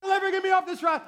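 loud, high frantic pleading; desperate praying. Retold or imitated. Preacher praying to God all through the ride, frantic pleading. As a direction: beg and apologise at full volume, comically panicked. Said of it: "I am praying".

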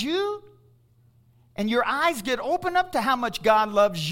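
loud, high rising preacher cry; preaching at full force. Real, not acted. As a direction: stir the congregation up.

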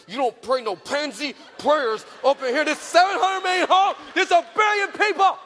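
loud, high, pushing pep talk; fired-up dad. Retold or imitated. Father hyping his daughter before going live, loud and high. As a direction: shout her into fighting form.

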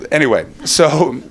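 ordinary delivery

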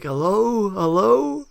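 high-pitched mock phone answer; [name]. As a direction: imitate picking up, pitch climbing to a question. Imitating guest answering the phone.

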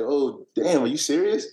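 imitating his own floored reaction; oh damn, really?; shocked disbelief. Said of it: "I was like".

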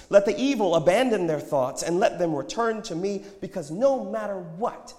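loud, high proclamation, easing at the end; proclaiming prophet. A narrator's reading. Prophet gives the crowd God's invitation, proclaiming.

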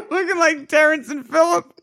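high, loud laughing words. Real, not acted. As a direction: squeeze the line out through laughter. Still laughing at the image.

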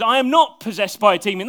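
loud, forceful denial; emphatic denial. Acted. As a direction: declare it firmly, hitting not. Voicing Jesus' firm denial.

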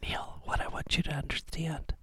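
unvoiced mock-secret whisper. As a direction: breathe it like a secret. Whispered mock confession to Neil.

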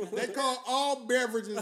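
ordinary talk

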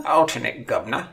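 hammed-up British "Alternate governor", high and rising; pompous English butler voice; mock posh British announcement; British accent. Shown as a demonstration. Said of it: "with a British accent".

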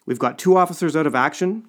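high, loud outburst; alarmed exclamation. A narrator's reading. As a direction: exclaim it in dismay. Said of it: "he exclaimed".

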